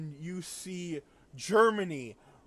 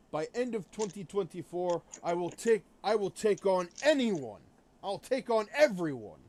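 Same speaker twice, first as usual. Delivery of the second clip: louder mock threat as Russia, slightly higher; imitating Russia; 3 semitones higher, 6 dB louder than usual. Retelling a video where Russia boasts, mock menacing voice. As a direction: ham up a boastful Russia daring anyone to fight. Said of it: "he was acting as Russia".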